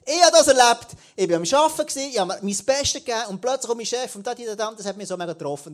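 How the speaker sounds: telling it worked up. Pretend. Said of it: "Dann erzählst du in der Small Group".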